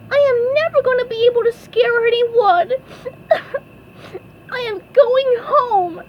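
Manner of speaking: high, fast tearful whine; SpongeBob crying. A narrator's reading. SpongeBob gives up and leaves, high sobbing wail. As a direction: blubber it in a squeaky cartoon voice. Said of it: "SpongeBob started crying".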